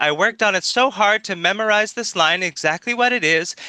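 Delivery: high, self-satisfied reciting of a line; mock rehearsed delivery. Debater mocking how the actress delivers her line, over-rehearsed. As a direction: parody an actor proudly showing off memorized lines. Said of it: "she just delivers the line".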